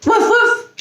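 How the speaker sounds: high-pitched quick woofs; eager puppy bark. A narrator's reading. Puppy scratching at the playground gate, high eager barking. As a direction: bark like a pup wanting in. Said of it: "Lucky barked as he scratched eagerly".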